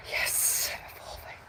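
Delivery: a breathy aside, almost silent; whisper. Shown as a demonstration. Vlogger repeating her key word to viewers, whispered.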